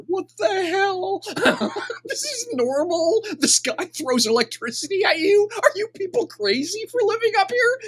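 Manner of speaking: high, incredulous questions through laughter; bursting with laughter. Acted. Newcomer reacting to sky storms, high laughing disbelief. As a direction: squeal it in giggly disbelief. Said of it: "She bursts out laughing".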